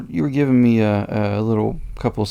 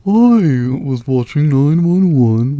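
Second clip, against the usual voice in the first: slow, higher mocking imitation; mimicking put-on voice; 4 semitones higher, slower than usual. Teasing co-host about the show, mocking put-on voice. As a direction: stretch it out, aping someone's silly voice. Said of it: "What was that voice?".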